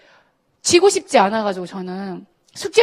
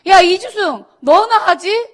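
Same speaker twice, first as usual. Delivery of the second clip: put-on teasing kids; 8 semitones higher, 6 dB louder, slower than usual. Kids pushing it onto her.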